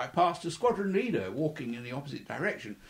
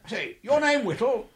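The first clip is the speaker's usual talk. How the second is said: higher, lightly voiced officer asking a question; a hint of a brisk superior checking your name; senior officer's query; 9 semitones higher, 4 dB louder than usual. Senior officer quizzing young pilot, lightly voiced higher query.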